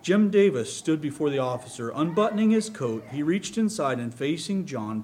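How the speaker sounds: usual speaking voice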